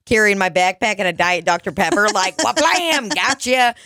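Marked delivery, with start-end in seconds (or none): 2.4-3.7 punchline sound effect. Retold or imitated. Mimicking punchline.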